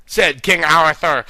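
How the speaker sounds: plain reading voice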